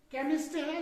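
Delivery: ordinary talk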